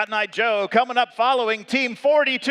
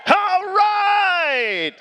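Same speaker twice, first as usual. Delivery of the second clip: loud high hype shout; rally the crowd, all right; 8 semitones higher, 3 dB louder than usual. Real, not acted. Hyping the crowd before competition.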